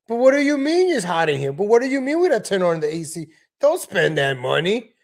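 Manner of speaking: put-on high kvetching old New York parent; Seinfeld's parents. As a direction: whine like a cheap old parent about the AC. Imitating Seinfeld's parents complaining.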